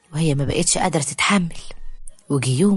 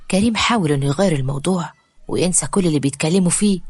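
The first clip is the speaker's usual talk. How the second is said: usual speaking voice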